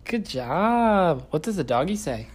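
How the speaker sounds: put-on high, sing-song voice for a small child; reading to a toddler. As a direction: coax a toddler, bright and playful.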